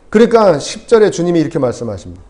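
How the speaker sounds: normal talking voice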